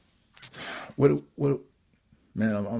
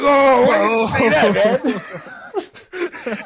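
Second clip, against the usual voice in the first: very high, loud mock wail; dismayed outcry; 13 semitones higher, 6 dB louder, much slower than usual. Rapper reacting to the bold introduction, mock dismayed outcry. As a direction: cry out in comic embarrassment.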